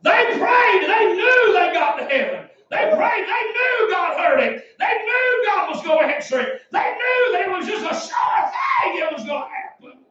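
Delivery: high, emphatic revival preacher; full-force preaching. Acted. Preacher to church on old timers' prayers, full-force preaching.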